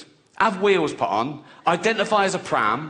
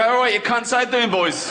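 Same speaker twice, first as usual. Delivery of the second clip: higher-pitched, put-on working-class greeting to builders; working-class matey accent; 6 semitones higher than usual. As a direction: lay on working-class bloke talk. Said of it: "act more working class around builders".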